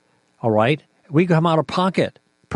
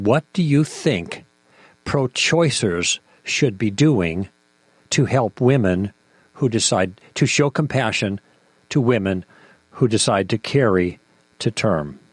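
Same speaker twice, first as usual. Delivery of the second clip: deliberate question; 3 semitones lower than usual. Shown as a demonstration. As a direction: pose the challenge phrase by phrase, with pauses. Models a pointed follow-up question.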